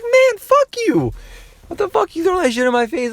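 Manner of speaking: very high, loud mock-furious yell; hammed-up outrage. Kid exploding at his teasing uncle, hammed-up outrage. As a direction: blow up in squealing mock rage. Acted outburst at prying uncle.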